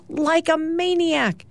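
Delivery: high, drawn-out mock scream. A narrator's reading. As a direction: pitch it way up and stretch it, without real volume. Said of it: "bounces off the bed screaming".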